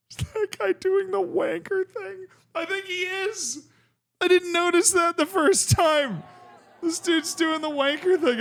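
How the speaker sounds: high-pitched, incredulous outburst; amused disbelief. Real, not acted. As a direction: spot it and squeal in delighted disbelief. Amazed at spotting a rude gesture.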